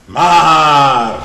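the mother's loud objection to the Lord; loud pleading "Maar". Acted. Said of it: "zegt ze".

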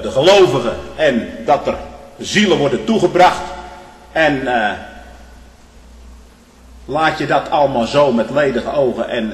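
fiery sermon rebuke; preaching at full force. Acted. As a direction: thunder God's complaint at the congregation.

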